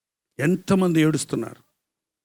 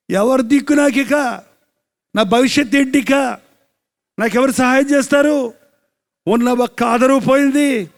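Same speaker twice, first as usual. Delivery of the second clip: high, pressing mock lament of a mother; preached lament; 8 semitones higher, 6 dB louder than usual. Preacher voicing a widowed mother who lost her son, high preached lament. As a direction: act out her despair in a raised preacher's voice. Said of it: "అని కుమిలిపోతూ ఉంది".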